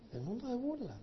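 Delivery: mocking echo. Shown as a demonstration. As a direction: let the scorn creep into it quietly. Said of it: "y el mundo se burla".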